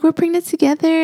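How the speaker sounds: high-pitched, gushing delight; imitated excitement. Sister-in-law thrilled to be pregnant together, imitated excitement. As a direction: mimic her delight, voice way up.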